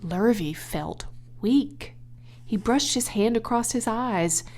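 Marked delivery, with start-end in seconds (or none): none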